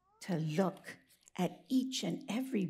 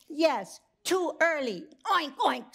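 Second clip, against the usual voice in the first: pigs' voice; 6 semitones higher than usual. Acted. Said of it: "said the pigs".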